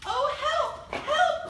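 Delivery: squealing high panic cry; a scared piglet shrieking for help; cried for help. A narrator's reading. Little pig fleeing the wolf, squealing high for help. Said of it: "cried the little pig".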